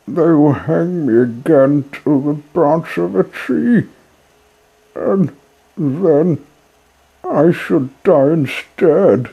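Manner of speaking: put-on young puppet voice, tearful and desperate; grieving puppet boy. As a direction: beg the dead fairy to wake up. Pinocchio laments dead fairy.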